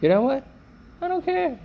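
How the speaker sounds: sudden high-pitched defiant "you know what"; defiant squeak. Real, not acted. Vlogger shrugging off fame, high squeaky defiance.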